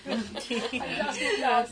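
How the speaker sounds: laughing while imitating a child, slow; child quote through laughter. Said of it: "one of the children there said".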